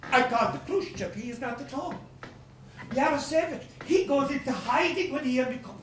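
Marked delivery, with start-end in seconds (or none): none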